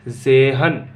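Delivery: जेहन as German zehn, opening on a buzzed z, no t heard; silent t. Shown as a demonstration. Teacher modelling German ten for the class, जेहन as "ze-han" without t. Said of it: "तय silent होता है".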